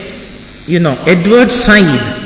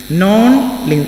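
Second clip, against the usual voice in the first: ordinary talk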